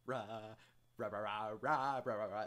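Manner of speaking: loud high robotic Rah chanting; blaring mock synth Rah rah rah; horrible utau imitation. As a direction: ham up a broken voicebank's R. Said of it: "is also a good test".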